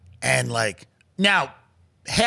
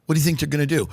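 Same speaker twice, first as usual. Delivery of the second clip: ordinary delivery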